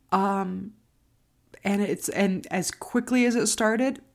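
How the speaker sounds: ordinary talk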